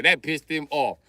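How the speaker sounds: ordinary talk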